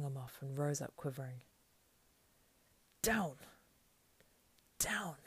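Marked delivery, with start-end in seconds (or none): 3.0-5.2 high, short gasped order; gasped command. A narrator's reading. Hobbit ordering his attacker down, gasped. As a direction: gasp it out as a raised command. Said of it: "he gasped".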